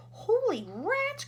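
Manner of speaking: high, loud, slow astonished cry; a drawn-out shocked exclamation; cried out. A narrator's reading. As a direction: gasp it out in amazement. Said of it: "cried Shanks".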